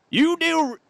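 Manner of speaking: false start of a Southern drawl; Southern accent. Acted. Trapper starts to scold the player, Southern accent.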